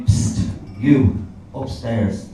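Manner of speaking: low, hushed furtive proposition; quiet, secretive offer from a doorway; woman in a doorway. Retold or imitated. Woman propositioning a passer-by in Soho, furtive and hushed. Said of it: "this woman leaned out of a doorway".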